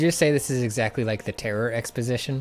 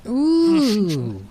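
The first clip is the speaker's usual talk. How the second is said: high, loud drawn-out "ooh"; intrigued ooh; 13 semitones higher, 8 dB louder than usual. Real, not acted. Co-player hears a new question, intrigued ooh. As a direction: let out a big ooh that slides down at the end.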